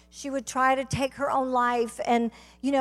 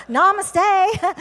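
loud, higher Hindi in a Southern accent; 7 semitones higher, 8 dB louder than usual. Shown as a demonstration. Teacher shows how her girls talk, Southern-accented Hindi. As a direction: greet in Hindi with a Southern drawl. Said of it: "speaking Hindi with a Southern accent".

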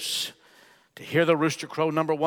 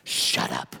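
low, voiceless snap at an alarm; snapping back. Retold or imitated. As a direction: cut the warning voice off in a harsh whisper. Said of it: "the pilot can be heard snapping back at that voice".

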